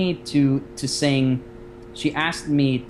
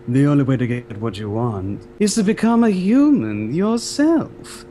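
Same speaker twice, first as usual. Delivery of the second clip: slow, theatrical villainous sea witch; silky, over-dramatic witch making her pitch; in character; 3 dB louder, slower than usual. Shown as a demonstration. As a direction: draw it out grandly, swelling loud then dropping away. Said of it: "a little bit of a lower tone".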